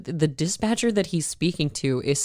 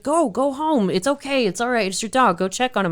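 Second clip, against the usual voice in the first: much higher, eager boss urging; 8 semitones higher than usual. Retold or imitated. Boss telling his employee to go check on his dog, high and eager. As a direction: push him out the door kindly, voice up high.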